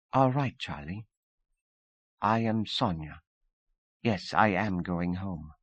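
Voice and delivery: lightly voiced Russian woman, composed; Sonia, hint of accent.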